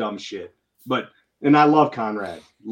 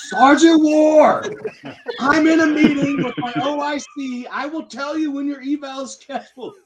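exasperated sergeant; 11 semitones higher, 6 dB louder than usual. Retold or imitated. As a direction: imitate a superior losing patience on the phone. Retold exasperated sergeant snapping at caller.

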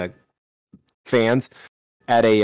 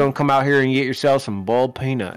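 Southern game announcer; faster than usual. Retold or imitated. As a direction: rattle it off like a folksy Southern announcer. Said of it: "the announcer from that football game".

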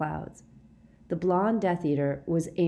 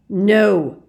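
very loud, raised single word; roar; 4 semitones higher, 12 dB louder than usual. A narrator's reading. Snape stopping the torture, roaring. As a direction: roar it to halt everything. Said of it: "roared Snape's voice".

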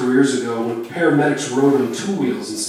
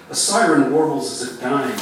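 plain reading voice

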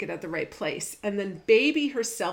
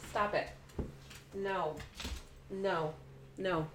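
soft scolding; 9 dB quieter than usual. Real, not acted. Scolding the dog quietly.

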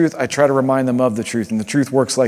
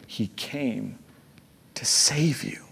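whisper; 9 dB quieter, slower than usual. Real, not acted. Tender close of sermon point.

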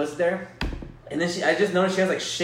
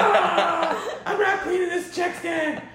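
mock yell; 13 semitones higher, much slower than usual. Coworker refusing to clean.

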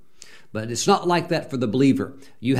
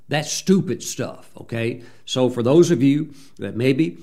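normal talking voice

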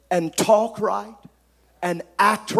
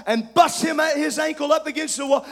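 ordinary talk, nothing marked

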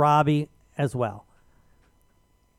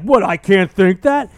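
mock outrage; 7 dB louder, slower than usual. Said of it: "And you're like".

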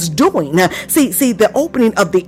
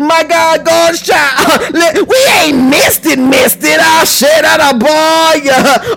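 higher, driving exultant preacher voice; fervent preaching; 4 semitones higher than usual. Real, not acted. Preacher stirs congregation between bursts of tongues, fervent preaching. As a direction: punch phrases and stretch out the praise.

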